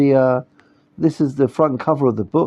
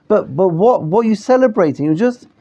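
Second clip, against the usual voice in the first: ordinary delivery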